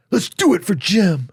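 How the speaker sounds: high, loud pep-talk voice; rally the cast with a high cheer. Retold or imitated. Rallying cast before the show.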